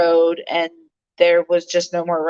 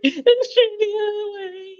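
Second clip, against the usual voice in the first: very high crying panic; shrill sobbing mock panic, much higher; panicked cry. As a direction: cry out in terror at the semi. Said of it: "I was like".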